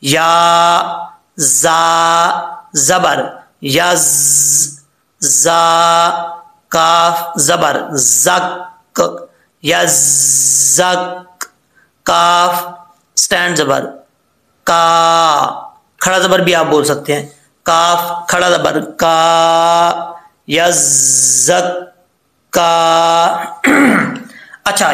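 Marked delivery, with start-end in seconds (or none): 0.0-15.8 letter by letter, slow sustained level chant; spelling-out recitation. Shown as a demonstration. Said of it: "हिजे करके पढ़ लेते हैं". 17.7-23.6 काफ, खड़ा जबर, का with long held का; spelling-out recitation. Shown as a demonstration. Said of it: "हिजे करके पढ़ लेते हैं".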